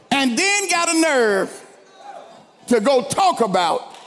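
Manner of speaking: building, high-pitched preacher's exhortation; impassioned preaching. Real, not acted. Preacher rebuking the congregation for ungrateful talk, impassioned.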